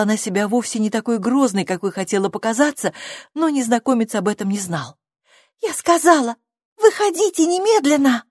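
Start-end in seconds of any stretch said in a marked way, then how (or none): 5.6-8.2 put-on high, loud insistent child's order; stern command. A narrator's reading. Girl pretends to be stern with stranger, stern command. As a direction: insist sharply, brooking no refusal. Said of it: "строго ответила Сьюзи".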